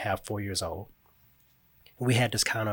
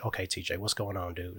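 put-on softer, a touch tender, coaxing a sulking child; gentle fatherly check-in; 3 semitones lower, 7 dB quieter than usual. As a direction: ease up and gently ask the boy what's wrong.

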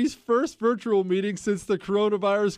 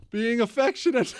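words collapsing into laughter; cracking up; 4 semitones higher than usual. Real, not acted. Host reading out the lewd interruption, cracking up. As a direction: barely finish the phrase before laughing. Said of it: "I can't stop laughing".

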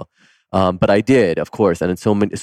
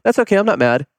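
put-on high, sweet little-kid voice, pitched way up; 12 semitones higher than usual. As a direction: play a small forgiving child, light and high. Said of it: "just like this little kid".

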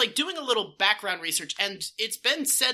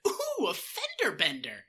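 delighted surprise; 13 semitones higher than usual. Retold or imitated. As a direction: act like you found candy. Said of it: "his expression is like".

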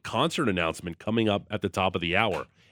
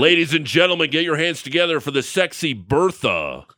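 loud, higher club announcer hype; strip club DJ; 6 semitones higher, 4 dB louder than usual. Pretend. Host announces unsexy names to stage, hammed-up DJ voice. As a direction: roll out a grand mock introduction. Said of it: "being a strip club D J voice guy".

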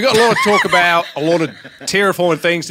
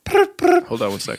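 put-on phone voice; 12 semitones higher, slower than usual.

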